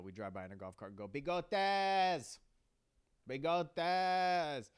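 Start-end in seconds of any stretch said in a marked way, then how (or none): 1.1-4.7 loud, high shout across the street; yelling. Retold or imitated. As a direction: yell his name from a golf cart. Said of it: "we would just yell".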